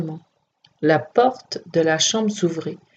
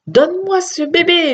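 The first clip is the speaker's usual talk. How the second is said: demanding; 11 semitones higher than usual. A narrator's reading. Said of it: "lui demanda-t-il".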